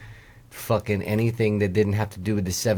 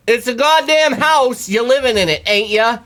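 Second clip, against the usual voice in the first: much higher, loud hollering retort; mock angry landowner; 11 semitones higher, 6 dB louder than usual. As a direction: yell it back like an irate landowner. Retold landowner shouting back.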